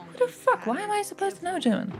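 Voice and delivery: high incredulous outrage; fast, high-pitched exasperation; outraged squeak. Real, not acted. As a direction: shriek your disbelief up high. Outraged rant about Germans.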